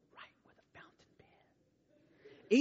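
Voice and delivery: a soft whispered joke; whispered aside. Real, not acted. Joking aside to the students, whispered. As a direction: slip it in under your breath.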